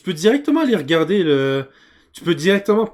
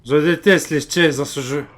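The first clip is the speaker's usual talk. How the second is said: ordinary delivery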